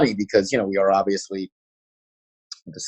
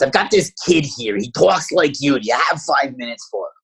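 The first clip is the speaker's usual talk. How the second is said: higher, raspy Northeastern lady; 6 semitones higher than usual. Retold or imitated. Retired district consultant sizing up a young guy, raspy Northeastern voice. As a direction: mimic a raspy district lifer. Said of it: "raspy Northeastern voice".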